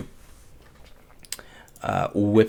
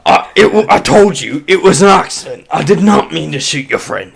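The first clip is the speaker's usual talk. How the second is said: high, loud frantic pleading; panicked excuse; 6 semitones higher, 10 dB louder than usual. Acted. Goon insisting the shot was an accident. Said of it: "and he's like".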